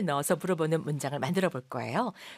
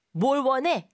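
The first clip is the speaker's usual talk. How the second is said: blunt; 7 semitones higher, 5 dB louder, slower than usual. Shown as a demonstration. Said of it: "방실방실 웃으면서 하는 말 같진 않죠".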